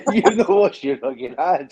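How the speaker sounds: loud laughing mock scold, slow; hammed-up telling off from the loo door. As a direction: bark it out half laughing.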